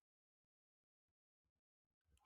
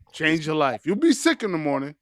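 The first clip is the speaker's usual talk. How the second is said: ordinary talk, nothing marked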